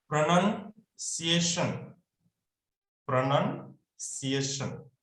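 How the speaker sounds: slow, careful "प्रोनंसिएशन" with the short "nan", not "naun"; model pronunciation. Shown as a demonstration. Teacher modelling "प्रोनंसिएशन" slowly for his students. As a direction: say "प्रोनंसिएशन" slowly, syllable by syllable, for the class to copy. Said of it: "तो इसको बोलेंगे प्रोनंसिएशन".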